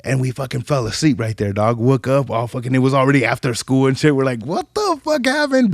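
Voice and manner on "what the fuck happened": high, bewildered shout-question; startled confusion. Retold or imitated. Kids waking up after school, high bewildered question. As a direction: wake up lost and alarmed.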